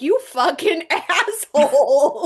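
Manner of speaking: slow, drawn-out curse breaking into laughter; laughing mock insult. Friend calls afterwards about the prank, laughing mock outrage. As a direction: stretch the insult out, laughing through it.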